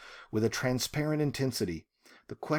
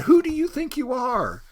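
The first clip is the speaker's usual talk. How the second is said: much higher, louder yell at the sky; yelling at God; 11 semitones higher, 6 dB louder than usual. Retold or imitated. Ruby yelling at God, high and loud. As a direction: shout it up to heaven. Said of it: "It's like she's yelling at God".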